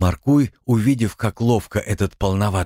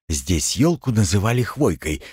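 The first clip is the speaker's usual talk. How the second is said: ordinary delivery, nothing marked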